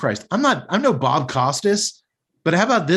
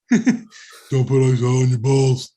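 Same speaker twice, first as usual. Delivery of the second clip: slow mock warning; much slower than usual. Slow, put-on voice.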